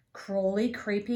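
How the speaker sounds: ordinary delivery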